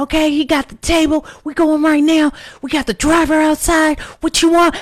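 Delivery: high, loud, fast DJ hype shouting. Retold or imitated. Drunk woman rallying her friend to the club, DJ hype shout. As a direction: hype them up like a radio DJ. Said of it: "I turned into, like, [name]".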